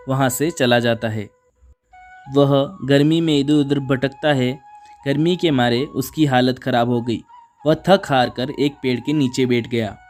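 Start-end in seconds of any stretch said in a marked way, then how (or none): none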